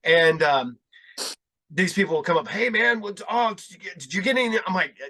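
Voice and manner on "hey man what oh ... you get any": higher, eager mock gym pest; chatty interrupter put on in a higher voice; pestering fan. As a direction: imitate someone butting in, pitched up. Pestering gym-goers acted out.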